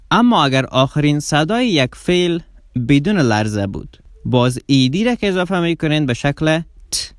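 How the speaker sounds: ordinary talk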